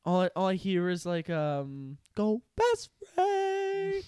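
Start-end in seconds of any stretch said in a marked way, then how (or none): 2.1-4.0 very high, slow squeaky meme voice; TikTok sound imitation. Imitating the dad's TikTok sound, high and slow. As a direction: stretch it out like a chipmunk meme.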